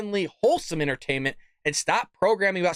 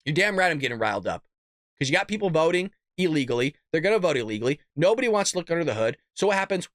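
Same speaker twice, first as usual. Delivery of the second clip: normal talking voice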